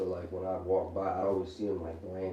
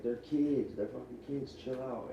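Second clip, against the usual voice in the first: higher-pitched retold reproof, not shouted; exasperated wife, imitated; 5 semitones higher than usual. Wife telling husband to ease off, imitated exasperation. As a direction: imitate a wife's weary pushback, pitched up.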